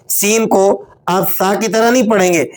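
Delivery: usual speaking voice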